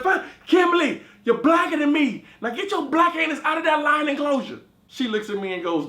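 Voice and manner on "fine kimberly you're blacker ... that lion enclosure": loud, high shouted surrender; exasperated giving in. Retold or imitated. Conceding to friend in lion's den, shouting. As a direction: yell it out to end it. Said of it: "I said".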